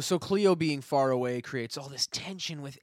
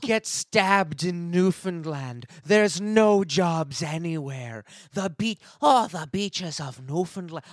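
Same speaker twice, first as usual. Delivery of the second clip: tourism ad voice; 4 semitones higher, 5 dB louder, slower than usual. Pretend. Mock tourism ad.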